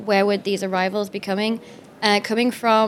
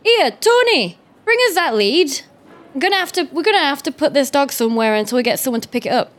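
security guard voice; 3 semitones higher than usual. Acted. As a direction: shout orders to a colleague. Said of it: "and be like".

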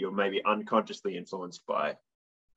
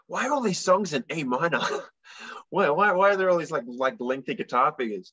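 mock exasperation; 7 semitones higher than usual. Mock exasperated self-questioning.

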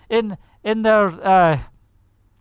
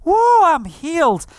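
rejoicing; 3 semitones higher, 4 dB louder than usual. Retold or imitated. Healed woman dancing before her friends, rejoicing. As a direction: celebrate out loud as the healed woman. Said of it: "She's just rejoicing".

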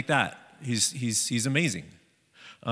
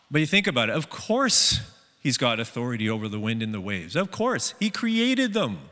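normal talking voice

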